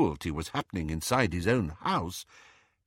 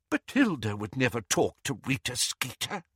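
ordinary delivery, nothing marked

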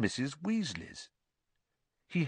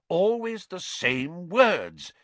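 slow, grave build-up; slow down to chill them; ominous; 9 semitones higher, 4 dB louder, much slower than usual. A narrator's reading. Father warning his wife, slow and grave.

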